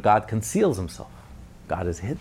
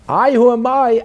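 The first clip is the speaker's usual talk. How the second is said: higher and louder, ringing out; awed divine question; 10 semitones higher, 7 dB louder than usual. Acted. Preacher voicing God at Sinai, ringing awed question. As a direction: call it out as God revealing himself.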